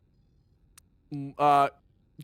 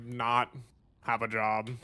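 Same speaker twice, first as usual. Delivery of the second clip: mock deadpan; 5 semitones lower, 4 dB quieter than usual. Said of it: "And I can't say".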